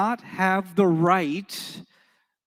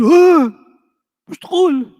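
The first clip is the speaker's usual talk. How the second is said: high, loud indignant protest; Moroccan friend protesting; 10 semitones higher, 9 dB louder, faster than usual. Retold or imitated. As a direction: object loudly in a much higher voice. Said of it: "You say".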